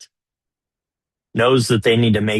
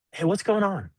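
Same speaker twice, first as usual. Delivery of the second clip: much higher, soft and caring; gentle curiosity; 9 semitones higher, 4 dB quieter than usual. Shown as a demonstration. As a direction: ask softly, genuinely curious.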